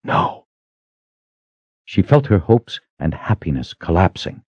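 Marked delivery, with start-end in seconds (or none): 0.0-0.5 tiny, crushed whisper; faint, soft answer; barely whispered. A narrator's reading. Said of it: "barely able to whisper the answer".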